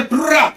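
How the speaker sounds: high rolled trill; quick trilled herding cry, high-pitched; imitated sheep call. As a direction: mimic a stranger trying to call sheep. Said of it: "ఇంకెవరైనా సరే".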